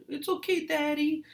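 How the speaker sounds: put-on very high baby-talk coo. Parent comforting a baby boy, very high cooing. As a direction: soothe a little boy in a high sing-song coo.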